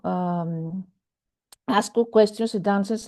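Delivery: usual speaking voice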